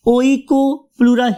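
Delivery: oikoplura with the O sounded, o-ee-koo-PLOO-ra; spelling pronunciation. Shown as a demonstration. Teacher helps students spell it, careful O-EE start on oikoplura. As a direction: pronounce oikoplura as written, opening on O-EE. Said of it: "तो इसके लिए आप इसे".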